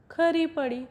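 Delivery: plain reading voice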